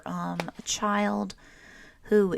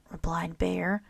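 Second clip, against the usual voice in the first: plain reading voice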